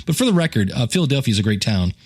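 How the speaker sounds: ordinary talk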